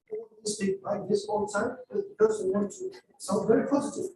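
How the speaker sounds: high, relentlessly upbeat "like this all the time"; upbeat monotony. Shown as a demonstration. Teacher mimicking learners who want to sound positive, upbeat monotony. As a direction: keep "sound very positive" pitched high and samey. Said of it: "different way of being monotonous".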